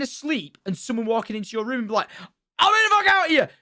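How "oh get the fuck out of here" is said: very high, loud sudden shout; re-enacted yell. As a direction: imitate bursting in and yelling. Imagined yelling beside a sleeper.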